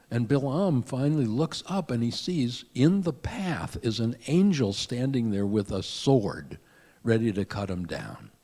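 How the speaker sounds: ordinary talk, nothing marked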